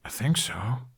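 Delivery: breathy unsure whisper. Acted. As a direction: barely voice it, unsure. Hesitant reply after lecture.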